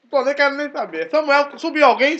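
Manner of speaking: mock-frantic king demanding answers; distraught Saul. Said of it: "ele tava todo transtornado".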